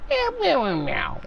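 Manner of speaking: high-pitched cat meows, voice jumping way up; cat meowing. Retold or imitated. Talking to the pet cats in a very high meow. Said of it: "now they're like".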